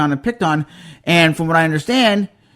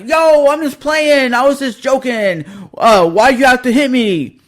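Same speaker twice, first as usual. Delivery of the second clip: whiny mock bully; 6 semitones higher than usual. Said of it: "was like".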